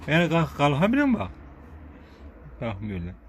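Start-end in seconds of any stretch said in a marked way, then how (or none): 0.1-1.2 excited coaxing. Pretend. Coaxing the animal to stand.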